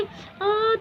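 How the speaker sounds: normal talking voice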